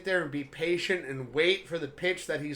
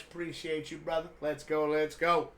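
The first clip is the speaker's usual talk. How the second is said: ordinary talk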